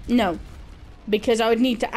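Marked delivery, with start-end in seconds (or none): none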